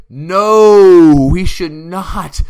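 a loud, flat-out no to the fan; emphatic no. Real, not acted. Answering the fan's question with a loud emphatic no. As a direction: slam the door shut with one word.

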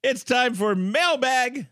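high, stretched-out announcer call; segment announcer. Pretend. Host introduces the listener mail segment, showy announcer call. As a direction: herald the segment with a slow, showy lift.

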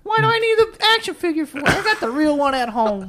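put-on high whiny kid voice; kid voice movie quote. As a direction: squeak it like a bratty kid.

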